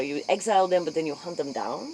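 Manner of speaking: normal talking voice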